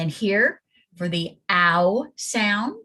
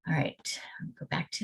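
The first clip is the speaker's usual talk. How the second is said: whispered to herself, barely voiced; whispered aside; 4 semitones lower, 10 dB quieter, faster than usual. Real, not acted. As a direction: whisper a note to yourself. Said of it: "aside to self".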